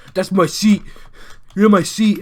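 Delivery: mock a whiny rival claiming a seat; hammed-up imitation of a schoolmate; insulting imitation. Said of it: "make him sound like that just to insult him".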